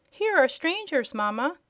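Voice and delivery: high, lightly voiced child calling out; childish call. A narrator's reading. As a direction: call to Mamma like an excited little child. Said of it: "the shrill and childish voice".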